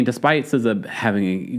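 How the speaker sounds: normal talking voice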